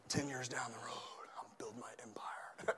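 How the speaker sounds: whispered, faraway musing; hushed daydream. Acted. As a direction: whisper it like a private ambition. Acting out men dreaming of future.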